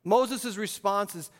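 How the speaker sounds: usual speaking voice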